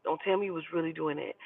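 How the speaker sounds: hushed low inner suspicion. Retold or imitated. Her spidey senses warning her, hushed and low. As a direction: murmur it like a gut feeling talking. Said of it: "my spidey senses is like".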